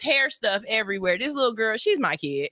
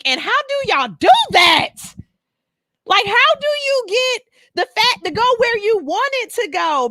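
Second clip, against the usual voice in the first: excited outburst; 8 semitones higher, 6 dB louder than usual. Real, not acted. Asking how to get curves.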